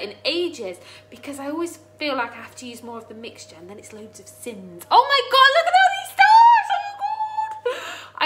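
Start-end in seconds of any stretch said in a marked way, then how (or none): 4.9-8.2 real high, loud squeal of amazement; overwhelmed excitement. Real, not acted. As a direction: gasp and shriek with tearful delight. Amazed at donated stars.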